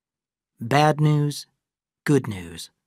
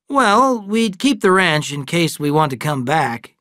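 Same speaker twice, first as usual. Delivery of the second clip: father reassuring, voice lifted; lightly voiced father, louder; [name]; 5 semitones higher, 6 dB louder, slower than usual. Father answering the twins' question, lightly voiced.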